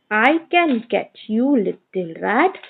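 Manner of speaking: ordinary talk, nothing marked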